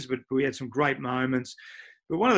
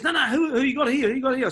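higher, louder eager questioning; imitating Sheeds; 12 semitones higher, 5 dB louder, faster than usual. Coach curious about the visiting young players, high loud. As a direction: press him, keen to meet the kids. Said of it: "He goes".

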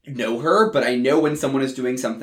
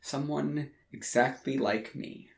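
ordinary delivery

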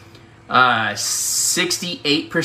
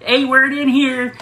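mom calling out. Retold or imitated. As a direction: call out for the missing daughter. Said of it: "She's like".

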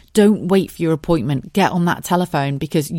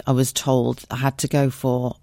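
normal talking voice

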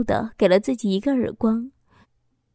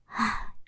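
weary sigh; 10 dB quieter than usual. Acted. Narrator sighs over her misery.

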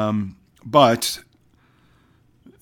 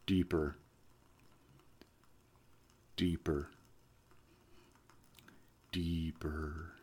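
soft hypnotic voice sinking quieter; hypnotist voice fading to hush; 5 semitones lower, 10 dB quieter than usual. Acted. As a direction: deeper and deeper, fading away.

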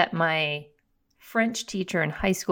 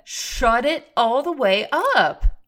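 excited; 5 semitones higher, 5 dB louder, much slower than usual. Real, not acted. Excited about the host's outfit.

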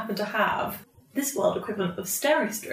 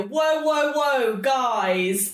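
drawn-out, pitched-up "whoa"; calling a halt; 5 semitones higher, much slower than usual. Acted. Player calls out to stop the guys, calling a halt. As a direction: rein everyone in, words stretched.

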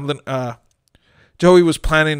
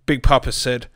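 plain reading voice

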